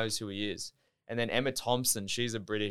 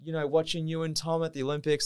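lightly voiced gushing woman, higher; gushing actress; 6 semitones higher than usual. Actress praising him for the Olympics, lightly imitated gushing. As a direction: imitate a star gushing, pitched up.

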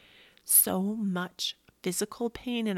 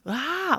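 high, loud gasp and "ah"; appalled gasp; 9 semitones higher, 6 dB louder than usual. Retold or imitated. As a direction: gasp in mock outrage. Appalled at daughter's attitude.

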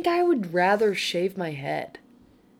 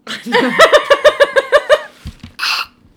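burst of laughter; 14 dB louder than usual. Real, not acted. Laughing before airplane voice.

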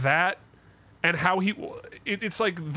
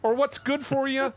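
much higher, strained mock-upset impression; Jordan Peterson; 11 semitones higher than usual. Actor losing it on his other self, hammed-up impression. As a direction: imitate an indignant rant, pitched way up. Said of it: "he's doing a Jordan Peterson impression".

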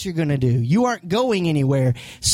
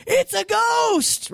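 much higher, slow scared yell; frightened shout; much slower than usual. Retold or imitated. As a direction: cry out in terror. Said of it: "that said".

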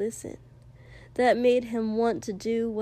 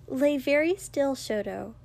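firm instruction, starting higher; 3 semitones higher than usual. A narrator's reading. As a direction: tell the patient firmly, settling on the name. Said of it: "Ochako said firmly".

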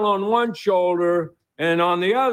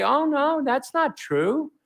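higher, reassuring wife protesting; hoped-for wife reply; 6 semitones higher than usual. Pretend. Host voicing the wife he hoped would reassure him, higher and protesting. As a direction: play the wife he wished would comfort him, pitched up.

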